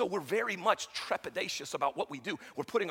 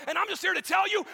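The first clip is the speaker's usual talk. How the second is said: higher and louder, full shout; shouted preaching; 13 semitones higher, 7 dB louder than usual. Acted. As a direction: shout it to the congregation. Repeated line, shouted take.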